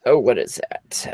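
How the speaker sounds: ordinary talk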